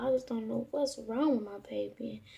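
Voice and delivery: ordinary talk, nothing marked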